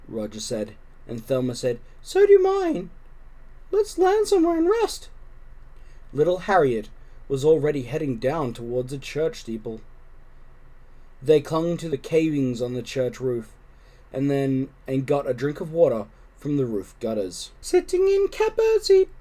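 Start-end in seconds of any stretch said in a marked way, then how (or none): 2.0-5.1 high, tired little kitten voice; a tired kitten asking to rest; kitten Thelma. Acted. Thelma to her siblings, high and tired. 17.6-19.1 high, sing-song little kitten voice. Acted. Harriet on the steeple, high sing-song. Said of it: "sang Harriet".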